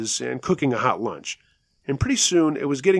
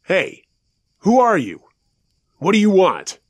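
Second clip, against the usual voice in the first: raised-voice demand at an intruder; loud tone; 8 semitones higher, 7 dB louder than usual. A narrator's reading. Dad challenging someone outside the tent at night, loud tone. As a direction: call out a loud challenge, pitched high. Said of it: "say in a loud tone".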